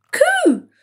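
high-pitched cry of wonder; amazed. A narrator's reading. Flea hearing the teapot sneeze, amazed. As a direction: exclaim in a tiny high voice.

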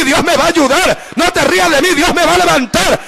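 ordinary talk

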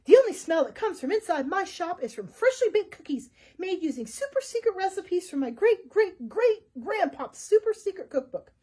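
loud and huffy; loud, huffy dodo shopkeeper; indignant dodo. A narrator's reading. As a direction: defend the shop proudly. Offended dodo defends his shop.

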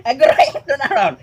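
real laugh breaking into the words; laughing at the dancing dog. Real, not acted. (tumatawang tunay habang nagsasalita)

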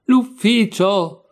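loud, high, stretched-out disbelief; incredulous echo. Acted. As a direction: echo L'ufficio as a drawn-out exclamation. Incredulous echo in acted quarrel.

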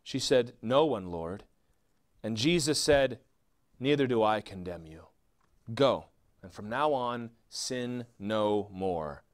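scripture reading. Acted. Preacher reading John eight to the congregation, measured. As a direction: read the passage with quiet reverence.

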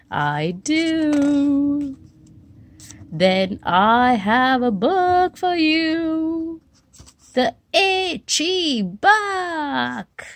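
high, slow, grand presenter voice; storybook character voice. Acted. Character proudly presents the book to a friend, high and slow. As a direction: unveil the book like a treasure.

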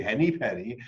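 plain reading voice

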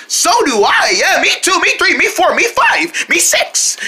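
high, squeaky chiming-in voices; excited little friends. Pretend. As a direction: pipe up eagerly, one after another. Puppet friends chiming in eagerly.

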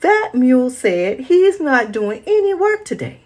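high, loud call for granddad; yelling boy. Acted. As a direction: shout across the farm for granddad. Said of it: "yelled the boy".

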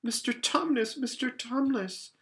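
high-pitched, distressed calling; great distress. A narrator's reading. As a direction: plead with him in alarm, voice lifted high. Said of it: "said Lucy in great distress".

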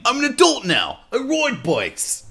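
high, drawn-out Aussie voice; Australian accent. Acted. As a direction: protest like a broad Australian.

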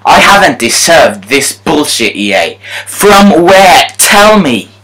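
high, loud demanding rant; raging at the game maker; angry rant. Real, not acted. Ranting at EA.

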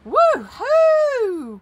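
high, loud whoop; thrilled, high-pitched holler; excited cry. Acted. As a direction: whoop with glee. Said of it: "cried Arthur".

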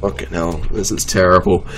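ordinary talk

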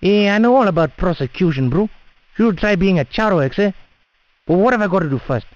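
ordinary delivery

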